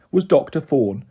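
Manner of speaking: ordinary delivery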